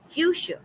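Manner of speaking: "Fuchsia" pitched high, as "FYOO-shuh"; pronunciation demo. Shown as a demonstration. Teacher echoing the played word for the class, pronunciation demo. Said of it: "is pronounced as".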